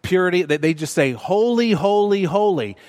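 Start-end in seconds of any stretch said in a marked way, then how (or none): 1.1-2.8 HOLY three times for emphasis, starting high and stepping down; insistent threefold "Holy, holy, holy"; emphatic repetition. Acted. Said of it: "to give you the emphasis".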